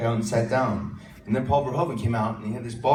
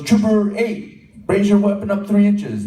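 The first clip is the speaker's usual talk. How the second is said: director's orders; 9 semitones higher, 8 dB louder, slower than usual. Retold or imitated. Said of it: "And then he goes".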